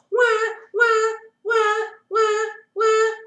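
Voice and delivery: put-on very high, loud baby wail; high, loud mock baby shriek; baby screaming. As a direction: scream at the needle in terror. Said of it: "it yelled as loudly as it could".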